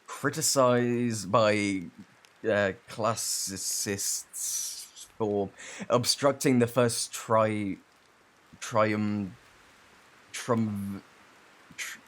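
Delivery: slow halting tries at "triumvirate", breaking off at "trium-" and "trum-"; drawn-out struggle to say "trium-", cut off each time; stumbling over a word. Acted. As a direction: fumble each try at "trium-" and give up mid-word. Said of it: "without mispronouncing everything".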